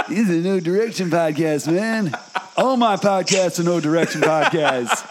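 hammed-up folk-singer accent; silly singer impression with rising swoops. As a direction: imitate a famous singer's lilting speech. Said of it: "Doing the [name] accent".

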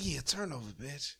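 mock bedroom command to a partner; low sexy murmur. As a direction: say it low and intimate. Sexy bedroom line acted.

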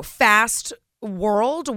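normal talking voice

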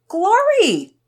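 high, loud call with a drawn-out name; excited yell. A narrator's reading. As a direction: yell for the lost cat. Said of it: "Pearson yelled".

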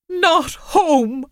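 high, crying protest; sobbed. A narrator's reading. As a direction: break into a sob on the words. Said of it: "as Mel had sobbed on the phone".

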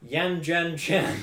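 slow, loud, drawn out; very slow announcement. Real, not acted. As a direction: spell it out, barely holding a laugh. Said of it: "I can't keep a straight face reading this one out".

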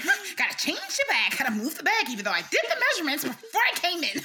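high, loud needling imitation; mock taunting. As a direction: mimic a taunting customer, rising into laughter. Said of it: "she was taunting me".

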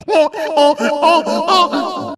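high repeating "oh, oh"; imitated looping radio drop; homemade soundboard effect. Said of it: "I have to do the sounds myself".

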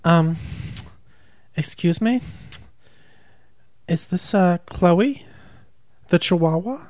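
haughty high phone voice; snooty caller. Retold or imitated. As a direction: sneer down the phone at her. Tanya calling snootily.

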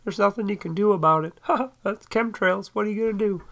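high put-on voice of people laughing it off; mock amused dismissal. Mimicking people who find chemtrails hilarious, mock amused dismissal. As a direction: imitate someone breezily shrugging it off. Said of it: "they think it's hilarious".

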